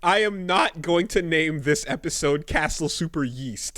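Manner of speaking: loud playful refusal. Real, not acted. Loudly refusing episode name.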